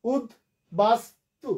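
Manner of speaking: উদ্বাস্তু very slowly as ud, bas, tu; syllable by syllable. Shown as a demonstration. Teacher showing three syllables, slow and separated. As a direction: pause after each beat of উদ্বাস্তু. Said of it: "তিনটা অক্ষর".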